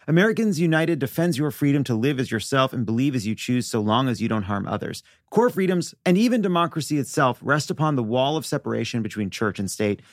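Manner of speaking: clear, measured sponsor read. Acted. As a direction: read it like a radio ad. Host reads sponsor ad.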